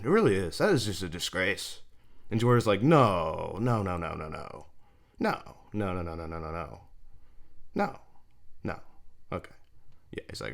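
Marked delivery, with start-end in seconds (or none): 2.8-9.5 muttered refusal. Retold or imitated. Acts out batter muttering refusals.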